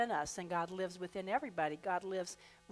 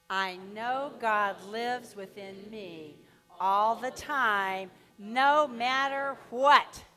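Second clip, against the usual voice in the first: slow group affirmation; 7 dB louder, much slower than usual. Acted. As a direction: build up and ring out the last phrase. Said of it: "let's affirm this with the two add-ons".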